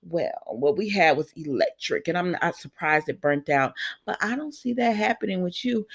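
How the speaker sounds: low hushed imitation of Audra; soft mock-sultry aside; mock breathy whisper. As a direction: whisper it like a smug rival. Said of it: "Audrey talking about".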